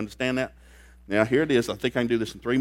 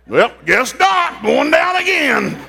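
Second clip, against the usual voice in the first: much higher and louder, building to a comic shout; Paul fed up with sinking ships, yelling out; exasperated Paul; 14 semitones higher, 8 dB louder, slower than usual. Retold or imitated. Paul exasperated about shipwrecks.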